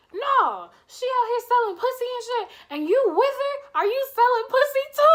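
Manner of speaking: high, loud, fast accusing rant; wife blows up, rapid and shrill; angry tirade. Retold or imitated. Wife yelling accusations in the street.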